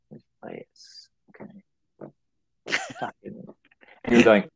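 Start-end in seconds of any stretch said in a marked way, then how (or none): 0.1-1.6 barely audible whisper; whispered aside. Retold or imitated. Audience member during a dull stretch, whispering. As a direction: whisper it as a private verdict.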